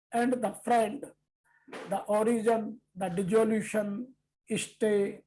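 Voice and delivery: solemn scripture recitation, each epithet its own line; verse recitation. Acted. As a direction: lay out every title of the Lord with a pause between. Reads verse in English. (श्लोक का ठहर-ठहर कर गंभीर पाठ)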